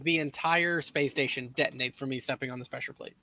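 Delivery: usual speaking voice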